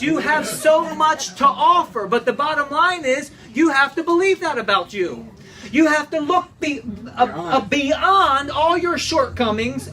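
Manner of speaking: rally them to believe in themselves; preaching at full force. Acted. Pastor urging the congregation, rousing preaching.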